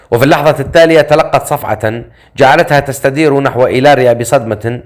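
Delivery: ordinary delivery, nothing marked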